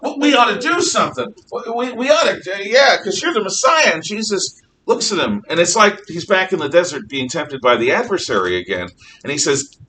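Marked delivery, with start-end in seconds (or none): 0.0-3.9 high-pitched, louder burst of zeal; acted eager urging. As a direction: stammer it out like an over-keen disciple. Acts out eager Peter urging Jesus.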